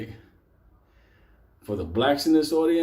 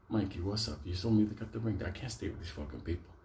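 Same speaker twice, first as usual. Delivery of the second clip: low, very soft conspiratorial murmur; hushed aside; 5 semitones lower, 10 dB quieter than usual. Retold or imitated. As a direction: quietly press an accomplice without being overheard. Retold furtive aside to accomplice Mike.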